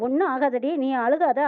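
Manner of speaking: usual speaking voice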